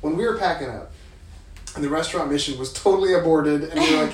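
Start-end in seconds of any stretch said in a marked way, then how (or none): none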